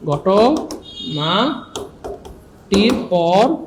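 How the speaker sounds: ঘটনাটির পর spoken very slowly, পর stretched on a rise; drawn-out পর. Shown as a demonstration. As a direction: stretch the word পর. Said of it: "teacher drags key word". (পর শব্দটা টেনে, সুর তুলে বলা)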